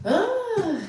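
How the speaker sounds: sudden high, loud vocal release. Real, not acted. Alone on the live before starting, high loud exhale. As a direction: let out one long high cry, rising then falling.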